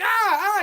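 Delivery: high "ah, ah" of someone hit; mock pained cry. Husband getting shot by his wife, high mock cries. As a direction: imitate a shot victim crying out. Imitating a man being shot.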